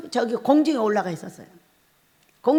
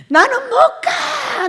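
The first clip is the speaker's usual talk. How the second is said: high wailing shout, stretched out; crying shout; 13 semitones higher, 4 dB louder, much slower than usual. Retold or imitated. Crying out in heaven that she will not go, wailing shout. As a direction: wail it out like refusing to die. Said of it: "소리를 지르면서 우는데".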